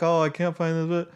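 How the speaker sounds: put-on child stuck on homework, mildly whiny; frustrated kid. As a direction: whine like a kid who lost the pencil. Family member stuck on homework.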